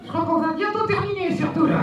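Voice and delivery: goofy muffled sock puppet. Acted. Puppet cuts in on the child.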